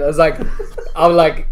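ordinary talk, nothing marked

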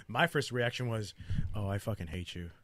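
ordinary talk

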